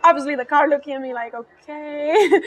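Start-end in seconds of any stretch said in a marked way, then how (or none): 1.3-2.4 drawn-out, hesitant okay; wary mock okay. Car watches its ranting owner, drawn-out wary okay. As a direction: stretch it out as if humouring someone. Voicing the car's wary reaction.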